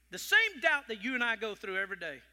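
loud, fast, driving sermon line; preaching loud. Real, not acted. Preacher driving the point home, loud and fast. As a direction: press it home to the crowd.

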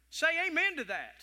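high and loud call. Real, not acted. Preacher calling for the congregation's amen, loud. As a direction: demand the amen.

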